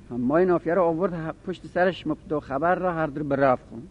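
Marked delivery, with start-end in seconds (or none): none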